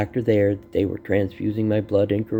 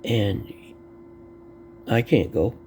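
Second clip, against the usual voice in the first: ordinary talk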